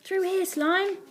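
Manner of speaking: high, acted call. A narrator's reading. Boy spots a window, calling to Slime. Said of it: "he called out".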